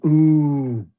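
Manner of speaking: usual speaking voice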